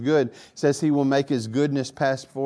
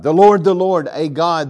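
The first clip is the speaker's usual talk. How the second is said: much louder, declaiming; booming proclamation; 4 semitones higher, 9 dB louder than usual. Acted. Preacher reciting God's name to listeners, in a loud booming voice. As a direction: ring out the divine name. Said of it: "rereading the proclamation".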